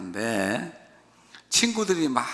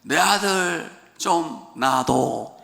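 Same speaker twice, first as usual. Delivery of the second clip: gruff Gyeongsang proposal; 3 semitones higher, 3 dB louder, much slower than usual. Retold or imitated. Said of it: "경상도 사람들은 반지를 주면서 그래요".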